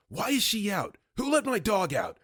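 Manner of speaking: high, harsh imitated demand; angry demand. Angry star demanding answers from the scared guard. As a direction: jab the questions like an accusation. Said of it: "puts his finger in my chest and says".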